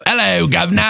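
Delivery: high, hammed-up Cockney greeting; Cockney accent. Host imitating his co-host's Cockney accent, hammed up. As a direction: put on a music-hall Cockney voice. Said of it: "[name] does a Cockney accent".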